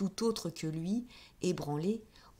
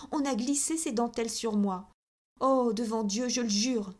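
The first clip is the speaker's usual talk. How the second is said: raised, furious protest of innocence; tears of rage; 4 semitones higher, 4 dB louder than usual. A narrator's reading. Countess swears her innocence to the managers, raised furious protest. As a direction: swear it before God, louder and louder. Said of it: "elle pleurait des larmes de rage".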